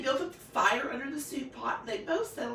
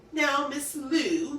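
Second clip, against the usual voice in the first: slow, coaxing, a bit louder; 5 semitones higher, 6 dB louder, much slower than usual. A narrator's reading. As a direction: sweet-talk her slowly. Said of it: "coaxed the wolf".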